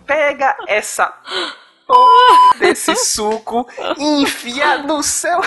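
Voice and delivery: slow, high, loud furious scream at the husband; furious yell. Retold or imitated. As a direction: bark it out like a woman in labour losing it. Angry wife in labour yelling.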